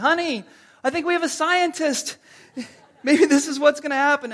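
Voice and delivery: high, loud call across the house; playful acted parental shout; mock yell. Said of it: "you, like, yell".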